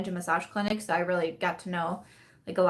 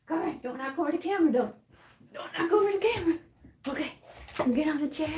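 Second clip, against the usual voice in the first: high sing-song dog voice; talking to the dog; 8 semitones higher, faster than usual. Real, not acted. Coaxing her dog up onto the chair, pitched high. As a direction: coax the dog up high and sweet.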